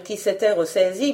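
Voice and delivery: usual speaking voice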